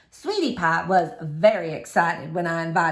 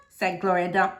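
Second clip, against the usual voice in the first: ordinary delivery, nothing marked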